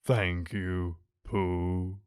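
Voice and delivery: low, slow, mournful donkey voice; gloomy Eeyore. A narrator's reading. Narrator voicing Eeyore to Pooh, low and glum. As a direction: drag it out like a sad old donkey.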